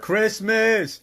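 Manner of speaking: loud high excited shout. Real, not acted. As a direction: blurt it out with excitement. Excited interjection over video.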